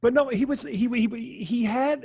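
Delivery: ordinary delivery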